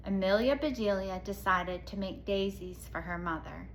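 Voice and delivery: usual speaking voice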